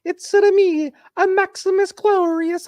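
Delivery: hammed-up Italian accent, very high pitched; Russell Crowe. Imagining a gladiator sequel, over-the-top mock Italian. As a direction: lean absurdly hard into a cartoon Italian accent. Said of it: "he leans really hard into it".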